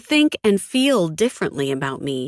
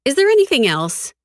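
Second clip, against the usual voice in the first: higher, louder dismissive question; perfunctory formality; 10 semitones higher, 6 dB louder than usual. A narrator's reading. She wants the twins gone, perfunctory question. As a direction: ask it only as a formality, voice lifted. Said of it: "I ask the question as a formality".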